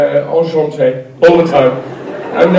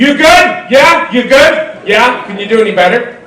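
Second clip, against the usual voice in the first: higher, loud, in-your-face pep checks; pushy American hype; 5 semitones higher, 4 dB louder than usual. Retold or imitated. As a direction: push them like an overeager trainer. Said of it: "People will say to you".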